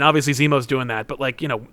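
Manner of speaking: normal talking voice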